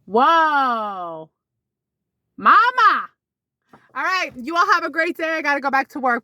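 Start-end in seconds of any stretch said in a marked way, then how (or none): 0.0-3.1 hammed-up impressed reaction to the photo; mock whoa. Vlogger to viewers over a risque photo of mom, mock wowed.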